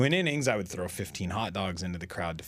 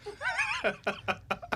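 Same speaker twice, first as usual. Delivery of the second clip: high giggle; 4 dB quieter than usual. Real, not acted. Laughing at his gimmick name.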